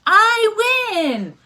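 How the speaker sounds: high, loud triumphant cry; gleeful win. Acted. Granny winning the dreidel game, high loud cheer. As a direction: crow over the win.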